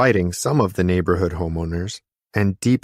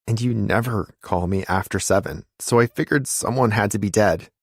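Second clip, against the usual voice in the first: usual speaking voice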